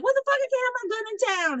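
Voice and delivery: mock indignant townsperson; outraged disbelief. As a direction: squawk it like you can't believe the rule. Townspeople outraged at gun ban.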